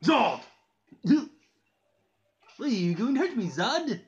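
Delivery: high, swooping clownish villain voice; drawn-out mock pleading with manic giggles; Joker. Villain forgiving Zod for hurting him, high manic voice. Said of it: "thinking about doing the Joker next".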